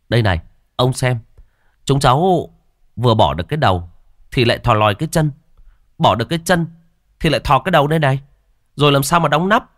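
agitated explaining. A narrator's reading. Young man shows the coffin problem.

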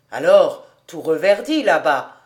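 lightly voiced hearty man; jovial man. A narrator's reading. Fritz hails the girl, jovially.

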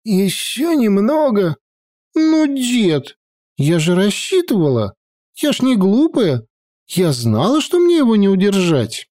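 put-on high, lively girl voice; sulky teenage granddaughter; young girl voice. A narrator's reading. Sylvia voiced.

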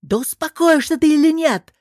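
high, loud yell; angry shouted demand to calm down; exasperated yell. A narrator's reading. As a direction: bawl it out, patience gone. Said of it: "заорал Алвар".

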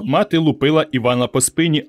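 ordinary delivery

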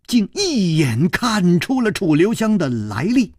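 ordinary delivery

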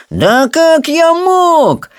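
very high, drawn-out wounded dignity; offended outcry. Acted. Rolla denies taking the gold, high offended cry. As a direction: cry out, deeply affronted. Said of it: "с видом оскорблённого достоинства вскричал Ролла".

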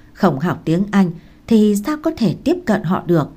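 usual speaking voice

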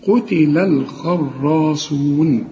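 قتل الخراصون recited slowly, light lam, heavy kha; Quran recitation. Shown as a demonstration. Teacher recites the verse for students, slow chant. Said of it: "لكن ما نقولش".

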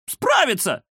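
very high squeak; squeaky. Acted. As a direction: squeak in support. Kuzya squeaks from behind.

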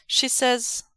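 ordinary delivery, nothing marked

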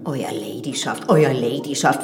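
parroting the stiff title with disdain; mocking his formal address. Widow mimics the priest's formal title, mocking. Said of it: "wie ich das hasse".

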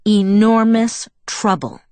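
ENORMOUS drawn out and hit hard, then TROUBLE set apart; slow emphasis. A narrator's reading. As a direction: stretch Enormous, stress each word as a warning. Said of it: "emphasizing each word".

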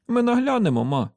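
put-on high-pitched boy's voice; young boy. A narrator's reading. Son assuring his mother, high and boyish.

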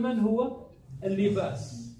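normal talking voice

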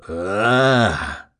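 long acted death cry; drawn-out scream. A narrator's reading. Narrator voices enemy being cut down, drawn-out scream. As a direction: let out one long wordless cry.

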